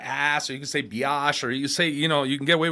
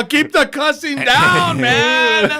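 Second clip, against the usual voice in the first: slow, higher mock-stern voice; mocking his cohost; 10 semitones higher, 7 dB louder, much slower than usual. Mocking the host's no-cussing rule, slow and high-pitched. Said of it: "Since he's all".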